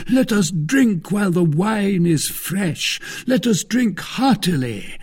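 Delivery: high, loud, jubilant toast; exuberant Aramis. Acted. Joyful Aramis calls for drink.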